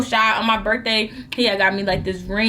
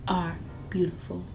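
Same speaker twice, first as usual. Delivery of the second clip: hushed, low self-encouragement; whispered pep talk; 4 semitones lower, 5 dB quieter than usual. Retold or imitated. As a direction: whisper it to yourself in the mirror.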